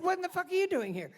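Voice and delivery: fast, high, loud mock outrage; staged yelling. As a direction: yell it for eavesdroppers to hear. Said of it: "start yelling".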